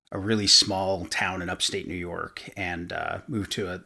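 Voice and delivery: normal talking voice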